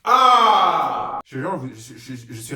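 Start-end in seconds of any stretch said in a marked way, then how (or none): 0.1-1.2 loud high cry of exasperation; frustrated shout. Real, not acted. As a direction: let out an exasperated yell. Frustrated outcry.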